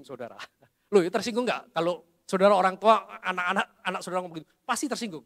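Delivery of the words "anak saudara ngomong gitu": ordinary delivery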